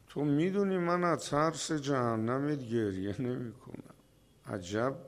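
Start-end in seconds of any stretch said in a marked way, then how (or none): none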